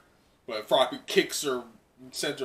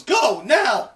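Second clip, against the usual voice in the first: high, loud order to go; urgent shout; 11 semitones higher, 10 dB louder than usual. Acted. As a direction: push him out the door with it. Uraraka urges Deku to run.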